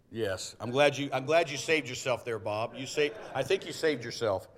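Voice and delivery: ordinary talk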